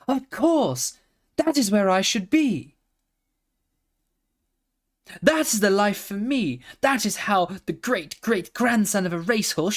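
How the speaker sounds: high, bright-eyed pony voice; a young pony struck by an idea; excited pony. A narrator's reading. Pony to himself, thrilled at his idea.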